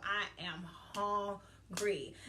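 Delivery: slow, spaced-out "I am hungry"; emphatic declaration. Real, not acted. Speaker declares her drive for success, emphatic. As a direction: stretch out "hungry", each word standing alone.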